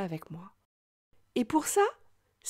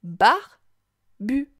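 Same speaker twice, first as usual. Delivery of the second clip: "Barbu" split into "bar", pause, "bu"; syllable by syllable; 7 dB louder than usual. Shown as a demonstration. Teacher helps child hear bu, "Barbu" broken apart. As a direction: sound out "Barbu" slowly in two separate beats. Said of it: "Tu entends bien la syllabe bu".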